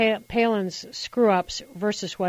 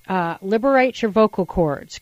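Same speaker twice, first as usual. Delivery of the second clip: ordinary talk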